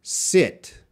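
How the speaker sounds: short lax i in "Sit", mouth barely moving. Shown as a demonstration. Teacher modelling "Sit" for the class to repeat. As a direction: keep the i in "Sit" short and relaxed. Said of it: "My mouth is not gonna move too much".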